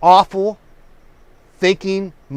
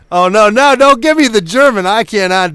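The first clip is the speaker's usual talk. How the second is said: high, loud laughing protest; no no, louder and higher in mock panic; 6 semitones higher, 7 dB louder than usual. Real, not acted. Protesting request to speak German.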